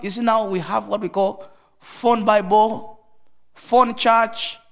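ordinary talk, nothing marked

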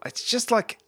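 normal talking voice

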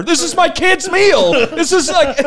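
embarrassed squeal of an excuse; flustered denial. Retold or imitated. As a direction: blurt it like you've been caught. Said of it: "I was like".

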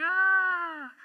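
high mock scream of a falling man; a hammed-up shriek as he drops. The atheist falling toward the monster's jaws, mock scream.

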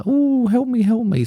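high, pleading snivelling voice; mock a whiny coward begging for help; whiny plea. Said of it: "Then he's like".

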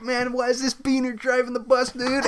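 high, mocking dude voice. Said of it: "Like, you're like".

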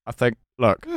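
lightly voiced stiff politician, with laughter; pompous, earnest ordering, half-laughing; mock Keir Starmer. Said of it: "perfect Keir Starmer".

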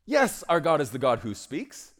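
loud, ringing declaration of God speaking. Acted. Preacher declaring to the congregation that God speaks, loud. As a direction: proclaim it to the room.

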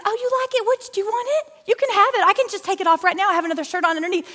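very high, rushing, eager-to-give mimicry; over-eager mom impression. Daughter mimics her mom offering up her own shirt, high and over-eager. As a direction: tease mom with a high, gushing voice. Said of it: "we still make fun of her".

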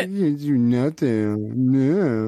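low, drawn-out dopey moan; slow, put-on voice of a useless fool; mock helpless caller.

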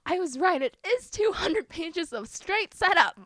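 loud, high-pitched indignant exclamation; vindicated outburst. Real, not acted. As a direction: burst out in exasperated triumph. Outraged at being proved right.

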